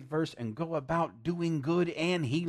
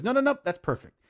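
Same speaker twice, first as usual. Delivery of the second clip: excited refusal; 9 semitones higher, 7 dB louder, faster than usual. Retold or imitated. Customer stops the haircut.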